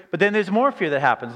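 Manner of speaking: ordinary talk, nothing marked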